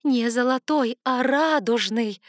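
rising to loud joyful insistence; excited realization. A narrator's reading. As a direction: cry out the discovery. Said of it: "Гулко перекатывалась мысль".

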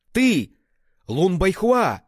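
very high, loud furious cry; voice-straining scream. A narrator's reading. Enraged father facing a new opponent, straining scream. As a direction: scream the name until your voice cracks. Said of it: "закричал Фа Шэньхао, срывая голос".

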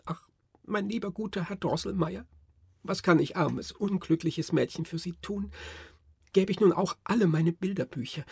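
put-on high, tearful, pleading girl's voice; sobbing girl. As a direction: plead through tears like a frightened little girl. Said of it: "schluchzend also zum Nussknacker sprach".

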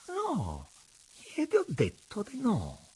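high, firm refusal; cold firmness. A narrator's reading. Daughter facing down her father's stare, coldly firm. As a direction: stand your ground, pitched well up. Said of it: "con fredda fermezza".

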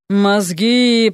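hoarse zombie groan. A narrator's reading. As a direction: rasp it out like a hungry zombie. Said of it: "прохрипел он".

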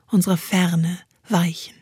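ordinary delivery

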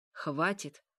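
insistent command, little real extra volume; firmer repeated command. A narrator's reading. Mikhail holds the vampiress, firmly commanding. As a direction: insist firmly, falling at the end. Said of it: "повторил ещё громче".

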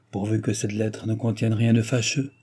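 ordinary delivery

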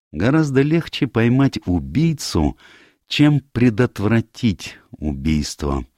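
high opening, slow weighty close; lightly voiced Poirot. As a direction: pronounce a grave maxim deliberately.

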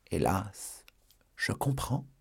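soft, wounded resignation; hurt, resigned sigh. Acted. La procureuse, blessée, répond doucement à Porthos. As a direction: let it fall away softly.

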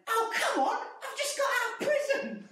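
high, whining yell; mock outraged woman just out of prison; shouting drunk woman. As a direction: whine it high like an aggrieved drunk. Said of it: "she just went".

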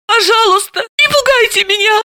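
high, thin, scared squeak; frightened squeak. Acted. Frightened suspect pleading with detective, squeaky. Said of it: "пискнула Заматаева".

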